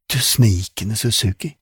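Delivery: plain reading voice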